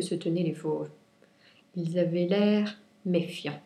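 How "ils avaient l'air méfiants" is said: ordinary delivery, nothing marked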